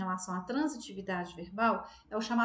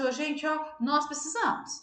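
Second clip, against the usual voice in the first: calling out, a bit louder; demo incomplete request; 5 semitones higher, 3 dB louder than usual. Shown as a demonstration. Teacher demos to the class an unfinished need, calling out. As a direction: tell the group what's needed without saying what. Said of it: "Eu falo assim".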